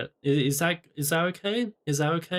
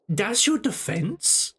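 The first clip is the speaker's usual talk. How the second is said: mock disbelief; 5 semitones higher, slower than usual. Mock judge reacts.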